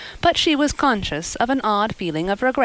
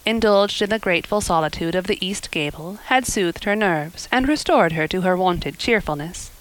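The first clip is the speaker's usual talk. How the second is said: usual speaking voice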